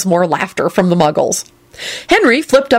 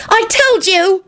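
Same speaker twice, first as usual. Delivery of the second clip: put-on high girl's voice yelling back; 13 semitones higher, slower than usual. A narrator's reading. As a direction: shout it back, hitting told. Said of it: "Ginny yelled back".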